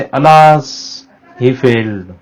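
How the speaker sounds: a touch of regret on alas, lightly sighed; mild sadness. Shown as a demonstration. Teacher showing students how alas sounds, mildly sad. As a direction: say alas with a hint of sorrow. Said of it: "ये sadness को express करता है".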